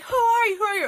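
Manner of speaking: high-pitched mock screech; imitated screaming. Storyteller mimicking the belligerent woman again, mock screaming. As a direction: mimic her demand in a quick, high squeal. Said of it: "screaming at the top of her lungs".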